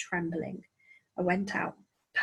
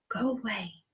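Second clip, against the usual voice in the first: a hushed, softer go away; soft whisper; 5 dB quieter, slower than usual. A narrator's reading. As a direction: say it under the breath, tired and spent. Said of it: "he whispered".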